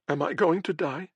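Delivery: high, small frightened whimper. Acted. Dying girl whimpering to the healer, high and frightened. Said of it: "she whimpered".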